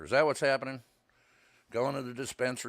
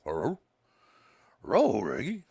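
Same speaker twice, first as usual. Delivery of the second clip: dog bark; 4 semitones higher, 9 dB louder, slower than usual. Retold or imitated. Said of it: "be like".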